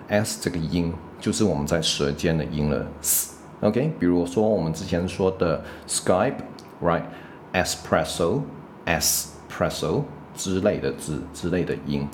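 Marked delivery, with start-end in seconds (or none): 5.9-10.0 slow, "Skype" and "Espresso" with a hissed tongue-tip S. Shown as a demonstration. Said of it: "都是在舌尖上面的". (放慢，"Skype"和"Espresso"的S用舌尖发出嘶声)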